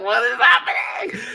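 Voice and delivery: loud, high shout of disbelief; shocked shout. Retold or imitated. Reacting as [name] loses it, shouting in disbelief. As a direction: yell it in shock. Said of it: "I was like".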